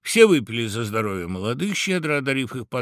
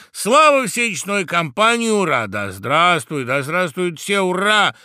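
raised, festive hurrahs; loud cheering; 7 semitones higher, 8 dB louder than usual. A narrator's reading. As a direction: cheer the whole company loudly. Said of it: "закричал дятел громко".

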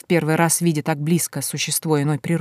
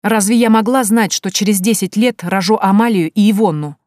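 higher, louder self-defence; exasperated, raised-voice justification; indignant protest; 4 semitones higher, 5 dB louder than usual. A narrator's reading. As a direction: protest heatedly that it is unfair. Дочь оправдывается в ссоре.

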